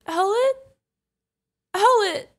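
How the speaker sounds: high, loud calls, questioning then urgent; alarmed calling. Acted. Guardian calls to unresponsive owlet, alarmed. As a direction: call out in rising panic. Kid stops responding.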